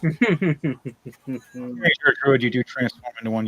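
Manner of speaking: ordinary talk, nothing marked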